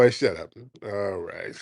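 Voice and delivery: ordinary talk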